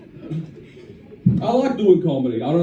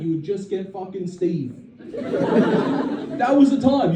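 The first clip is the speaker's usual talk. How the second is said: normal talking voice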